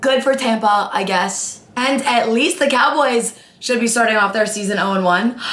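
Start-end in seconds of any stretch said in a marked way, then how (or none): none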